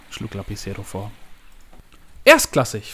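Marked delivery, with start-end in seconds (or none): 2.2-2.9 loud, raised exclamation of delight; jubilant. A narrator's reading. Boss delighted by secretary's suggested name, jubilant. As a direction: exult with a strong first syllable. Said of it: "frohlockte Javier".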